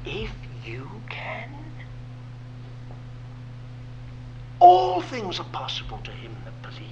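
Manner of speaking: soft whispered words of Jesus; Jesus answering the father in a hush; gentle whisper. Shown as a demonstration. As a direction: whisper it tenderly. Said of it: "And the Lord said".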